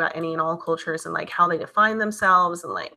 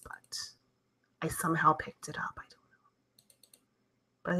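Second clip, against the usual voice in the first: whisper; 10 dB quieter, slower than usual. Real, not acted. Streamer whispering to chat about her own accent, hushed. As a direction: drop to a breathy whisper.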